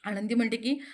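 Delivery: ordinary delivery, nothing marked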